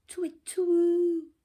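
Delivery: high, loud twit twoo; owl hoot. Acted. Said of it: "went the owl".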